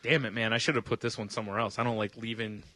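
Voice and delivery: usual speaking voice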